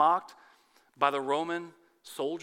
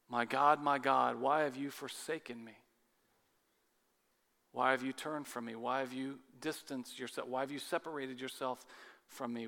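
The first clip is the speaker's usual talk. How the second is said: softer, low anguished questioning; anguished plea; 4 semitones lower, 5 dB quieter than usual. Acted. Jesus crying to God on the cross, in soft anguish. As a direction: ask it like a forsaken man.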